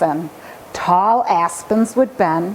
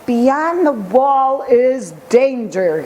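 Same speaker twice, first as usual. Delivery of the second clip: slow, solemn warning; slow solemn warning to children about the forest; 3 semitones higher, slower than usual. A narrator's reading. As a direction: warn the children gravely and slowly. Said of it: "in solemn tones".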